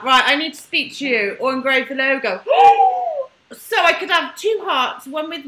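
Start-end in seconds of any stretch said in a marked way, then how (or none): 2.5-3.2 loud, high gasp of delight with a laugh; a real thrilled gasp breaking into laughter; excited gasp. Real, not acted. Cohost thrilled at two hearts, loud laughing gasp.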